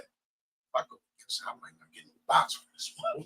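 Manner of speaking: hushed, high whisper of Adam's doubts; whispered inner thought. Retold or imitated. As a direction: whisper it like a secret worry to yourself.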